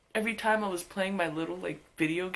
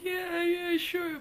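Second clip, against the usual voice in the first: put-on reluctant kid; 14 semitones higher, much slower than usual. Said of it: "I was just like".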